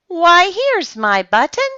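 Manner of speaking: high, loud happy exclamation; excited cry. A narrator's reading. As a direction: cry out in delight at finding it. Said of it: "he cried".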